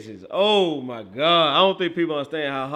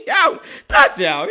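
drawn-out teasing shout; 2 semitones higher, 8 dB louder, slower than usual. Real, not acted. Teasing Ohio State.

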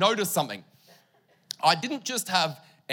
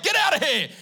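high, loud playful shout; wife banishing her sick husband, loudly; mock yell; 10 semitones higher, 4 dB louder than usual. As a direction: shout him out of the room. Wife throws him out.

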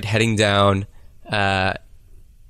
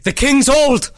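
much higher, louder protest; shrill prisoner outburst; 13 semitones higher, 3 dB louder than usual. Acted. Captive voice shouts through the door at the party, high and loud. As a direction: fling it back at them, pitched way up. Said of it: "and he's like".

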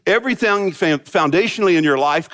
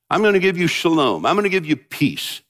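ordinary talk, nothing marked

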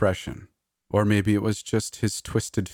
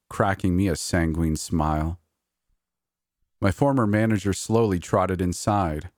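usual speaking voice

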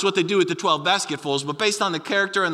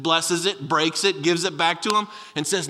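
ordinary delivery

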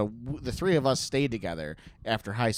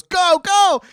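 urgent shout; 11 dB louder than usual. Retold or imitated. Urging friends to run.

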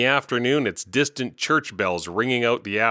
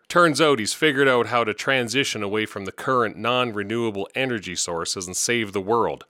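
ordinary talk, nothing marked

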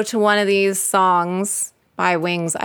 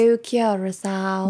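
mock British; 3 dB quieter than usual. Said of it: "Are we British?".

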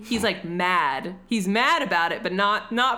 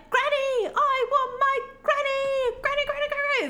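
put-on much higher whining little girl calling granny; whiny kid voice. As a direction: play a needy little granddaughter begging for granny. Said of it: "They're like".

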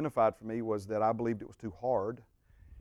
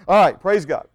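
ordinary talk, nothing marked

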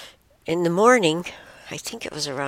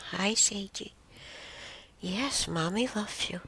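soft cooing aside; 7 semitones higher, 10 dB quieter than usual. Real, not acted. Mom softly coos to Seiji off to the side, hushed and higher. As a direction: coo to her little one under her breath.

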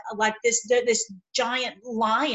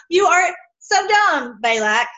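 louder, higher, slow scornful jab; mocking scorn; 6 semitones higher, 7 dB louder, slower than usual. Real, not acted. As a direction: taunt him like he is a fool. Preacher mocking King Balak directly.

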